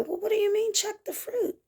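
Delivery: high, soft puzzled question; lightly voiced confused seeker; mock bewildered reply; puzzled asker.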